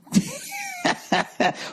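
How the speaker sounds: shrieking laugh. Real, not acted. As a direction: burst of high cackling laughter. Big laugh at his own line.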